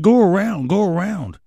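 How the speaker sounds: high, mocking imitation of the guy waving cars; squeaky put-on voice that makes fun of him; mock high voice of the roadside guy directing traffic; mocking the idiot.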